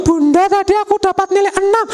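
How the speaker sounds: put-on high child's voice, hopeful; little child. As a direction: report the grade like a small kid. Child reporting grade, voiced. (suara anak kecil melapor nilai)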